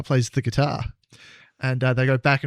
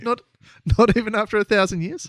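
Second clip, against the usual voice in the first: mock disbelief; 8 semitones higher, faster than usual. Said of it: "mock shock".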